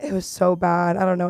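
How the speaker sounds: ordinary talk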